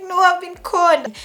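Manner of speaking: high, loud, drawn-out wail; acted-out despairing outcry; retold distraught protest. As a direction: wail it at Dad, acting it out. Acted protest to calming dad.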